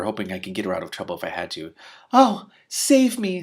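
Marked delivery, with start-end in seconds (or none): 2.1-3.4 very high, very slow swooning plea; melodramatic plea. Acted. Princess begging rescue from Shiara, swooning plea. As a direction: stretch out a fainting damsel's cry.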